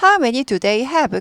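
slow, falling at the end; wh-question with a falling end; falling question tune. Shown as a demonstration. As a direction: let the end of "How many do they have?" drop. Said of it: "끝이 내려갑니다".

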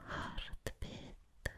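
"heartbeat." breathed as a faint whisper. Shown as a demonstration. Vampire breathing the word heartbeat to the listener, faint whisper. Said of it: "just the sound of saying heartbeat".

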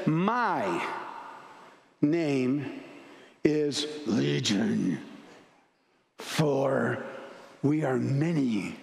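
deep, slow, drawn-out demon voice, words spaced apart; the many-voiced demon answering Jesus from deep down. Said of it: "And he says".

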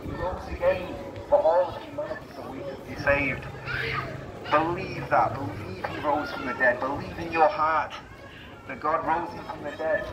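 usual speaking voice

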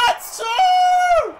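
very high, loud, drawn-out "two"; elated shriek. Real, not acted. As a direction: squeal it in triumph, holding the last word.

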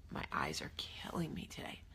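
whispered complaint. Real, not acted. Whispering to viewers about her sore eyes. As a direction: breathe it as a private aside.

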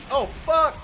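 high sudden swear; startled curse. Real, not acted. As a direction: blurt it out in shock. Sudden danger in game.

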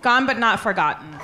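usual speaking voice

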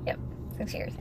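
a soft, hushed whisper of the boast; a private little whisper; whispered aside. Real, not acted. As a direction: whisper it to yourself, pleased.

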